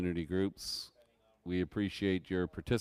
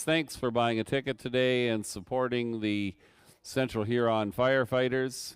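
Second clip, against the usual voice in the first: normal talking voice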